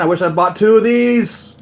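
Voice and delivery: loud, high-pitched excited wail; excited regret. Real, not acted. Wishing for a second figure.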